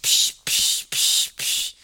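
repeated hushy "psh" noises; imitated splashing steps. Rider showing passengers stepping in pee, imitated splashes. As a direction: mimic each splashing step with a psh.

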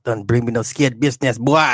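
mock Jamaican warning in natural pitch; Jamaican accent. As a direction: warn in put-on patois. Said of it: "talking that Jamaican accent".

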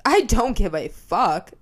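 loud, heated threat to a friend; furious threat. Retold or imitated. To the friend who almost said the slur, loud and angry. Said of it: "I was literally like".

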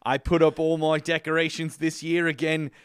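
hammed-up, rough New Zealand accent. Host reading a listener's review, hammed-up New Zealand accent. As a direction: put on your best amateur New Zealand accent. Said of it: "do my best New Zealand accent".

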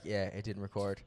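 casual, low and offhand, like no big deal; nonchalant. Retold or imitated. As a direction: shrug it off lightly. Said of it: "like, so nonchalantly".

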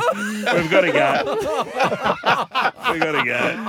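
laughing wrap-up. Real, not acted. Host ending the show while still laughing. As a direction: stretch it out while laughing helplessly.

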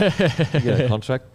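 words broken up by real laughter; teasing a mate while cracking up; laughing. Real, not acted. Laughing at army camp story.

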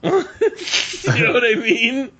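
high and slow through laughter; laughing. Real, not acted. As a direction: laugh while asking. Laughing at the storyline.

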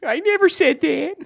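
tearful. Acted. Rick denies it, close to tears.

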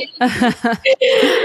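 a real laugh that nearly turns to tears; burst of laughter. Real, not acted. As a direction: crack up at the funny name. Host laughs at odd name.

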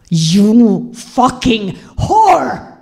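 high, very slow enraged cry; screamed insult. Retold or imitated. Husband cursing his unfaithful wife, screaming. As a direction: stretch each word out in high-pitched rage. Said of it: "he screamed".

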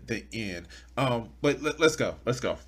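normal talking voice

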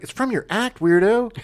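lightly voiced kid, pitched high; a hint of an indignant kid; kid's retort. Host imitating the kid answering the comedian, high mild retort.

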